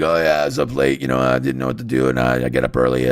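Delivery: imitating a rough, scratchy sick throat; mock sandpaper voice of a sick brother; raspy sick voice. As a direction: croak it out like a sore throat. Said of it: "it's just like sandpaper".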